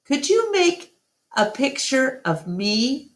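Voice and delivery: lightly voiced little elephant, hopeful; a little girl asking sweetly; small child's voice. A narrator's reading. Young Ruby begs Ivan for a picture, lightly childlike.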